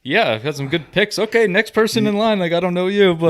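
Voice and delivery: mock the son brushing people off; imitating the son. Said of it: "It was just like".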